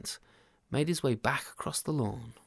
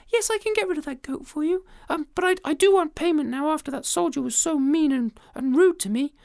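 very high, huffy demand; a small creature standing its ground; squeaky indignant ant; offended tiny ant; 11 semitones higher, 4 dB louder than usual. Acted.

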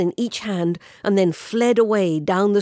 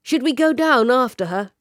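higher, louder questioning call; second pursuer's voice; 6 semitones higher, 5 dB louder than usual. A narrator's reading. One pursuer asking the other about following her, raised voice. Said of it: "A second voice said".

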